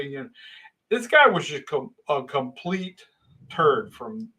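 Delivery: ordinary delivery, nothing marked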